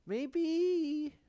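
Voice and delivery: high, loud, drawn-out maybe; long, high-pitched musing; sing-song maybe. Real, not acted. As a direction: stretch it out high, almost sung. Drawn-out musing while painting.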